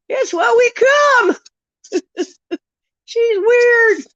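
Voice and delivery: high, laughing through the words. Real, not acted. Agreeing with chat that she's weird, laughing hard. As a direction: squeal it out while cracking up.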